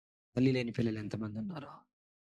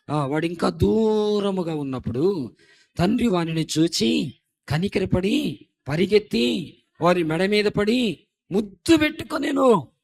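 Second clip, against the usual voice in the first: slow, louder solemn scripture reading; 7 semitones higher, 10 dB louder, slower than usual. Acted. Preacher reading Luke to congregation, slow solemn reading. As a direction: read the verse slowly, weighing each phrase.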